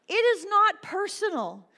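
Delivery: loud, high, insistent preaching; emphatic exhortation. Real, not acted. Preacher telling drivers it is not personal, emphatic. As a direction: drive it home loudly, pitched high. Exhorting listeners about driving slights.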